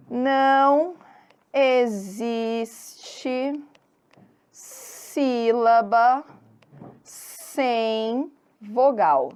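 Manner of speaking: very slow, word by word with long pauses; slow dictation. Shown as a demonstration. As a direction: stretch each word as if writing it out. Dictates the rule while writing it. (ditado bem lento, palavra por palavra)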